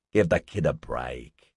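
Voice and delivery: low, slow grumble; complaining. A narrator's reading. Marty objects to Liz stopping Barry's fun, complaining. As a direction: drag it out as a mild complaint. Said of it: "Marty complained".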